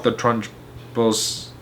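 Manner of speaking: usual speaking voice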